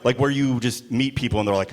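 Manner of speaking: ordinary talk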